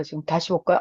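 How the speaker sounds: normal talking voice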